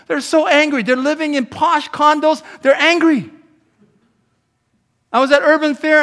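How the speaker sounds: high, loud preaching at full force; shouting sermon. Real, not acted. Pastor preaching loudly to his church about angry people. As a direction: preach it hard and loud to the room.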